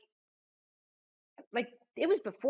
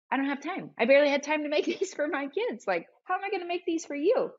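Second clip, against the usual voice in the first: flustered panic; 6 semitones higher, faster than usual. Retold or imitated. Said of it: "I'm terrified".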